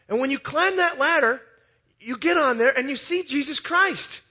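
high, loud urgent preaching; full-force preaching. Acted. Preacher urging the congregation to climb, loud and high. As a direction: lift the whole room to the climax.

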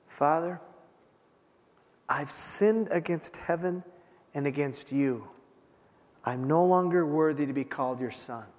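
a son crying in his father's arms; quiet broken confession. Retold or imitated. Son confessing to his father, quiet and tearful. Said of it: "he's crying".

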